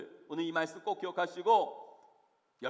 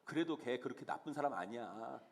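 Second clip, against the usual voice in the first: low, soft mock dissuasion; calming down; 8 semitones lower, 8 dB quieter than usual. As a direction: talk the angry friend down quietly. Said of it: "그럼 오히려 내가 말리죠".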